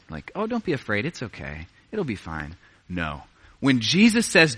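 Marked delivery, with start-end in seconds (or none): 0.3-2.6 light, brushing-off reassurance, softer as it goes; mock comfort that means nothing; dismissive pat on the head. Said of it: "like a pat on the head".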